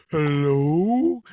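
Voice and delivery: loud mock tough call; loud hello. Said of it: "That's my defense".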